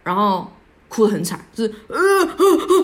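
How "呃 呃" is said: high, loud, drawn-out sobbing wail; wailing sob demo. Shown as a demonstration. Showing listeners how she broke down crying, wailing. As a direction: let out a slow, broken howl of crying. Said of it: "这样类似那种哭法".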